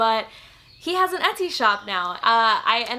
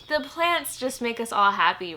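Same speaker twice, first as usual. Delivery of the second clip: normal talking voice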